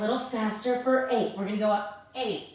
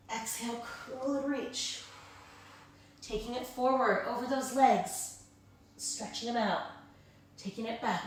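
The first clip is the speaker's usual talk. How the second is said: calm stretch cueing; 6 dB quieter than usual. Acted. Slow stretching cues.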